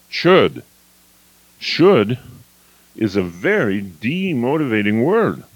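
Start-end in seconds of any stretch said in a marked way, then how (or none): 0.1-2.2 Should said louder with a falling tone; emphatic should. Shown as a demonstration. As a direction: land Should firmly, then drop. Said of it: "Right there".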